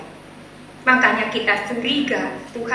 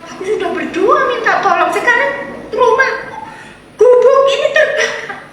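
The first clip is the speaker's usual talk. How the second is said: high, loud, frustrated complaint; castaway cries out angrily over his burned hut; angry outcry; 11 semitones higher, 7 dB louder than usual. Acted. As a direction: rail at God in anger. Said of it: "Marahlah dia".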